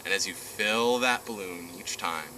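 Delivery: guided meditation. Acted. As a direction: ease them down into calm.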